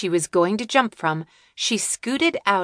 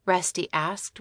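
usual speaking voice

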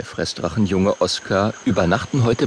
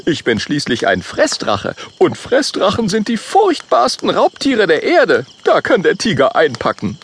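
higher voice, stress on uns; boastful Oskar; 8 semitones higher, 3 dB louder than usual. Acted. Oskar reassures friends about tiger, higher boastful voice. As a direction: brag with a higher voice. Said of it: "sagt Oskar".

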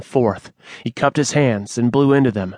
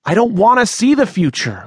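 desperate plea; 10 semitones higher, 3 dB louder than usual. Acted. Manny pleading with the snake.

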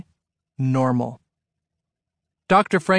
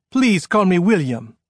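put-on higher-pitched French-accented man; 5 semitones higher, 4 dB louder than usual. A narrator's reading. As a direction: introduce yourself in a French accent. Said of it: "in a French-sounding accent".

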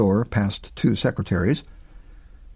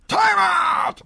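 high called-out timeout; holler. A narrator's reading. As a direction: call it across the field. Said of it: "Marquardt hollered".

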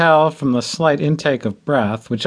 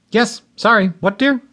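higher, flustered "what, dear"; startled; 8 semitones higher than usual. A narrator's reading. Groom caught daydreaming answering his new bride, startled. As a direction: snap back to attention, caught daydreaming.

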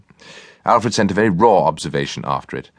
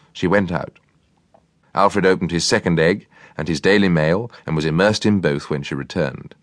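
ordinary delivery, nothing marked

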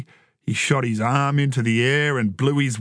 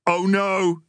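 higher-pitched excitable commentator; playful ringside alarm; joining in commentary; 6 semitones higher than usual. A narrator's reading. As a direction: join the mock wrestling call. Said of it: "Troy joined in".

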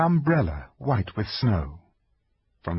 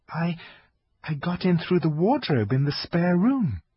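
put-on young girl voice; 8 semitones higher than usual. Lucy answers.

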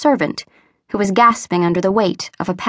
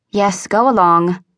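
ungracious consent; slower than usual. A narrator's reading. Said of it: "assented her mistress ungraciously".

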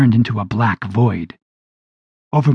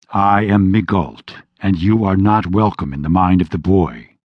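commanding guardian; 3 semitones lower than usual. A narrator's reading. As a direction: declare it with calm authority. Angelic guardian declares himself.

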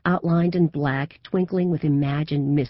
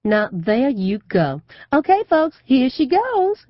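high, loud Southern belle playing to the crowd; loud announcement; 10 semitones higher, 7 dB louder than usual. A narrator's reading. Mother mocking her daughter to the crowd, in a loud, high Southern drawl. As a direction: announce it to the whole room. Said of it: "she says loudly".

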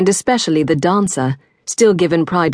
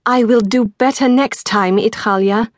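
higher, strained through gritted teeth; 6 semitones higher than usual. A narrator's reading. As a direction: force words past clenched jaw. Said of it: "through gritted teeth".

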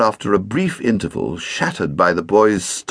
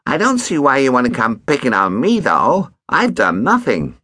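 higher, smug and slightly fast; complacent boy; 5 semitones higher than usual. A narrator's reading. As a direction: innocent act, self-satisfied. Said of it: "said his stepson complacently".

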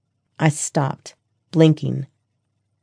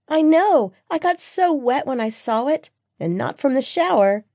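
put-on high, gushing girlfriend voice; excitable best friend; 9 semitones higher than usual.

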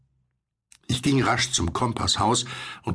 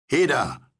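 raised, higher call; 6 semitones higher, 3 dB louder than usual. A narrator's reading. Narrator hails the unseen boat, calling out. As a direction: call out across the water. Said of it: "rief ich".

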